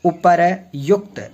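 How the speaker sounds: उपर्युक्त slowly in two parts, "upar, yukt"; उपर्युक्त spaced out slowly; broken-down correction. Shown as a demonstration. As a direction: split उपर्युक्त before the Y, deliberately. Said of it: "क्या होता है".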